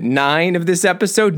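ordinary talk, nothing marked

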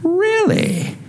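very high, incredulous question; exaggerated doubting onlooker; mock disbelief. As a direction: squeak it out in sceptical surprise. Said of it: "And they go".

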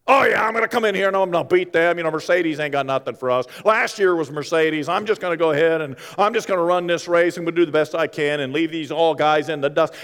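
fast, brash mock bragging; cocky racer. Preacher mocking an arrogant F1 driver's boasting, fast and brash. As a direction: swagger through it like a cocky young driver. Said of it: "they are so cocky and arrogant".

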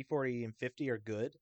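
usual speaking voice

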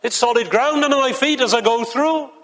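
lightly voiced friend urging him on; Hopeful reassuring. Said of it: "And Hopeful says".

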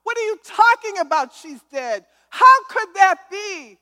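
loud, high anguished cry out to God; retold scream. Mother screaming to God over her daughter's death, retold scream. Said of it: "I started screaming".

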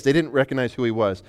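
ordinary delivery

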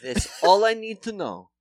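put-on thick Polish accent, confident; Polish dentist accent. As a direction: Polish accent impression, pleased.

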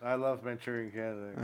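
flat, coerced confession; forced hostage statement. Pretend. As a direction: say it like you're being forced. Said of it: "It's so forced".

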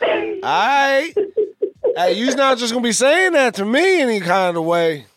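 high-pitched, hammed-up New York tough talk; New York accent. Host playing an offended New Yorker, New York accent.

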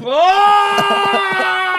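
sudden high loud Oh; startled outcry over the dice; surprised shout. Real, not acted. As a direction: yelp at the bad roll. Reacting to a failed roll.

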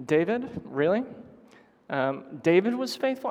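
sceptical rising questions; incredulous. Acted. Listener doubting that David was faithful, incredulous. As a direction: question it in disbelief, voice lifting. Acting out a doubting inner thought.

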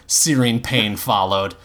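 ordinary delivery

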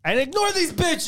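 mock diva aside.

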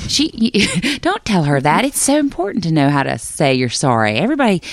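high-pitched, animated mock mother objecting; imitating protesting mother. Mother objecting to niece's homework, in a high mock protest. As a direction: play an insistent mom, voice lifted high. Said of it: "and then my mother, who's a seven".

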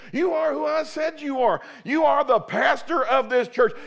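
loud, commanding declaration; the Lord commanding the pastor to rise. Retold or imitated. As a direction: thunder it like God's command. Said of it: "the Lord just spoke at the altar and said".

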